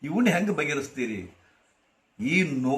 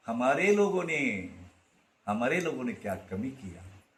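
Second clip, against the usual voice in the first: soft recitation; 4 semitones lower, 7 dB quieter than usual. Acted. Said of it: "repeating the verse softly".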